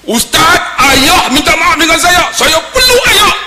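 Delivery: high, loud, drawn-out cry; impassioned high shout, acted weeping; imitated tearful outcry. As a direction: cry out overwhelmed to the ustaz. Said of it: "Menangis saya".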